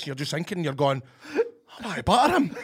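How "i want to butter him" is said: laughing through the words; can't keep a straight face. Real, not acted. Telling his co-host about the man behind, laughing.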